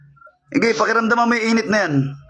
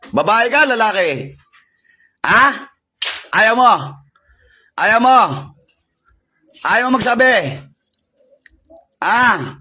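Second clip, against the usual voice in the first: raised, insistent questioning; demanding interrogation; 3 semitones higher, slower than usual. Real, not acted. Exorcist pressing a spirit that refuses to answer, demanding. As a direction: press them hard for an answer.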